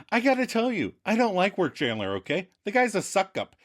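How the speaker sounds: high-pitched blunt complaint; Monica, frank disapproval. Retold or imitated. Monica criticising Work Chandler to him, blunt.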